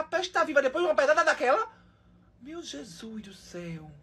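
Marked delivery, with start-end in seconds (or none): 2.4-3.9 slow, hushed exclamation; stunned disbelief. Real, not acted. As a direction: stretch it out very softly in dismay. Stunned reaction to the video.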